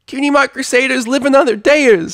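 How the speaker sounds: very high, loud fleeing yell; caterwauling. Acted. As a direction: wail it while running off. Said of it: "Sweetie Belle caterwauled".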